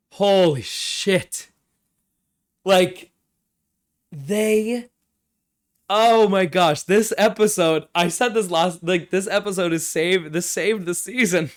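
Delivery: loud, high, giddy relief; a real fan's joyful outburst; excited gush. Real, not acted. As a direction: blurt it out, thrilled the episode delivered. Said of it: "a big sigh of relief".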